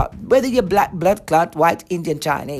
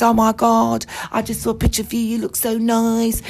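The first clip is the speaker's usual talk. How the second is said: put-on fawning compliment, pitched up; imitated gushing; 6 semitones higher than usual. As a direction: gush like a smitten caller, mockingly.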